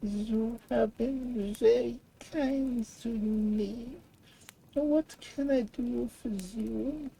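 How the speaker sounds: high, slow and feeble little voice; a hurt dragonfly barely able to speak; weak voice. A narrator's reading. Hurt dragonfly thanks the prince, in a weak high voice. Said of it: "said in a weak voice".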